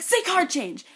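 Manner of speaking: loud, high, pushy shout; retold urging shout. Cam shouting to the fan to say it. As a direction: egg her on at full volume.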